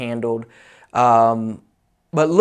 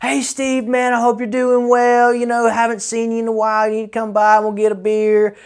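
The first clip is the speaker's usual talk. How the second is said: much higher, fake-chummy voice of the old customer; mock friendly customer; 9 semitones higher than usual. Customer phoning years later on Christmas, mock buddy-buddy high voice. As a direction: ham up the clingy customer's cheerful call. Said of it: "be like".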